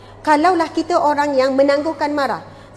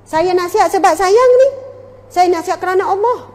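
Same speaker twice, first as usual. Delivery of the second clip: higher and louder, getting worked up; heated insistence; 4 semitones higher, 5 dB louder than usual. Acted. Adviser getting angry because advice was refused, higher and louder. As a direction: insist hotly on your own sincerity. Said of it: "Tiba kita pula nak marah".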